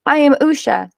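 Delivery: bold declaration. A narrator's reading. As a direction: declare yourself to someone behind a wall.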